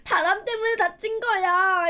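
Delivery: high, loud, drawn-out denial; mock protesting excuse. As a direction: act out a whiny teen excuse. 문 닫힌 변명을 연기. (높게 늘여 억울해하는 변명 흉내)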